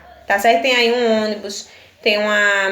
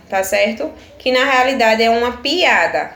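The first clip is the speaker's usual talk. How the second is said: usual speaking voice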